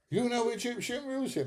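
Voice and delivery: very high, surprised falsetto; mock surprised taxi driver. Taxi drivers amazed he knows their music, squeaky falsetto. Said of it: "they go".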